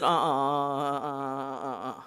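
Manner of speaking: disapproving uh uh. Retold or imitated.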